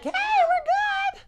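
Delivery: very high, slow bleating shout; hammed-up bleat-voice; mock goat yell. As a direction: imitate a baby goat calling out.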